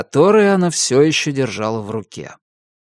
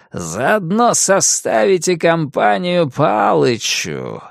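menacing darkness voice; slower than usual. Acted. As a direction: threaten them slowly as ancient evil. The Darkness threatens the heroes.

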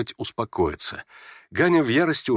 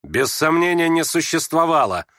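higher, decisive answer; cutting retort; 6 semitones higher, 3 dB louder than usual. Acted. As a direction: cut it off with finality. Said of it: "отрезал Ганя". (отрезая, решительно)

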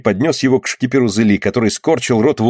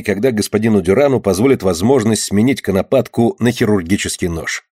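usual speaking voice

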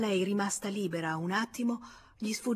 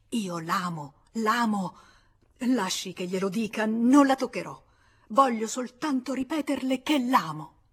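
passionate; 3 semitones higher, 7 dB louder than usual. Acted. Georges kissing her hand in the carriage, passionately. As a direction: press the declaration harder each time. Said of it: "baciandogliela appassionatamente".

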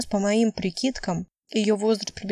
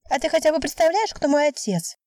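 put-on higher-pitched girl firing the challenge back; pitched-up, one-upping retort; indignant comeback; 8 semitones higher than usual. A narrator's reading. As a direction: throw the question back with a high, rising push. Girl throws the question back.

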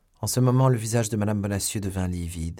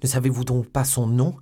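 louder, higher urgent question; alarmed demand; 4 semitones higher, 6 dB louder than usual. A narrator's reading. Young man pressing his beloved, alarmed question. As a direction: press hard for an answer.

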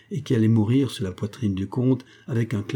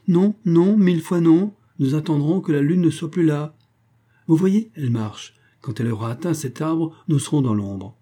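much higher and louder no, no; vehement refusal; 6 semitones higher, 4 dB louder than usual. A narrator's reading. Baroness flatly refusing the count, higher and louder. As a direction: refuse him outright, then reason.

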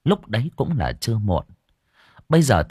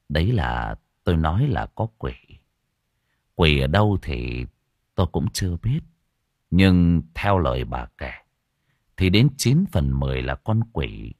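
put-on grave old monk; 4 semitones lower than usual. Monk warns the old woman, low grave voice.

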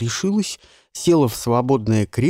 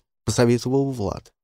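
ordinary delivery

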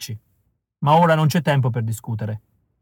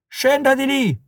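angry car owner yelling, much higher; furious shout; 12 semitones higher, slower than usual. A narrator's reading. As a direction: bark the order in a rage. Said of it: "urlò furibondo il padrone".

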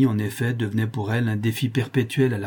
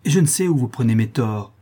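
raised, loud, defiant protest; indignant outcry; 5 semitones higher, 5 dB louder than usual. A narrator's reading. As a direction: refuse to submit, voice pushed up. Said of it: "s'écria-t-elle".

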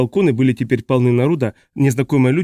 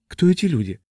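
higher-pitched puzzled question; surprised; 5 semitones higher than usual. A narrator's reading. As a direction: ask in mild astonishment. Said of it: "удивлённо спросила Грета". (удивлённый вопрос, выше тоном)

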